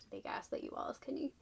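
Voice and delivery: a soft, voiceless whisper to the author; whispered aside. Real, not acted. Booktuber whispering to the author about the cover. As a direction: lean in and whisper the accusation.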